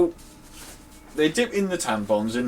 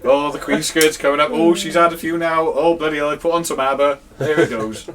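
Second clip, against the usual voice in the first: mock party commentary; 5 semitones higher, 6 dB louder than usual.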